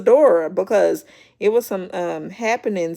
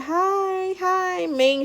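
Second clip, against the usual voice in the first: much higher, sing-song hello; sugary greeting; 11 semitones higher, much slower than usual. Retold or imitated. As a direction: chirp a fake-sweet hi at Ming. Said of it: "And then she said".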